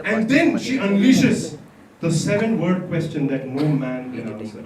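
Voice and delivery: ordinary delivery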